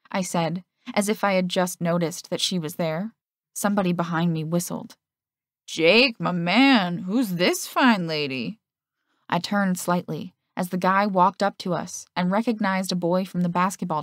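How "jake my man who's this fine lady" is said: a touch of cocky player, slowed down; lightly voiced smooth jock.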